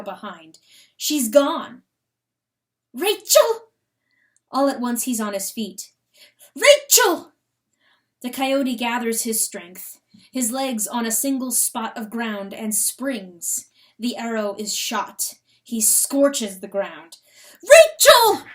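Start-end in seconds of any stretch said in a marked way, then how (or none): 2.9-3.7 panicked husband shouting for his lost wife; desperate shout. Acted. Coyote calling his vanished wife. 6.5-7.3 panicked husband shouting for his lost wife; desperate shout. Acted. Coyote calling his vanished wife. 17.6-18.5 highest, loudest cry of the name; husband screaming for his wife in terror; frantic scream. Acted. Coyote calling his vanished wife.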